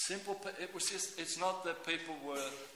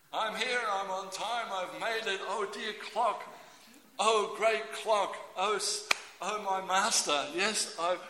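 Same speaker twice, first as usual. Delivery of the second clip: high, hammed-up devotion; mock worship; 4 semitones higher, 5 dB louder than usual. Worker clocking on before the clock, mock worship. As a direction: grovel to your great master.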